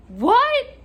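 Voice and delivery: very high, loud rising question; shocked disbelief. Retold or imitated. Clerk hearing coworkers broke into a house, shocked. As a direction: let the voice shoot up in astonishment. Clerk stunned by caller's accusation.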